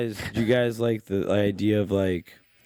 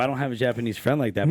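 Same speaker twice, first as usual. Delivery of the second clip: normal talking voice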